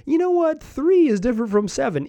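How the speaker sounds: imagined change of heart. Retold or imitated. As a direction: pitch it up as a hypothetical reconsideration. Imagines player changing his mind.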